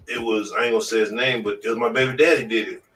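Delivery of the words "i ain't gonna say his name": normal talking voice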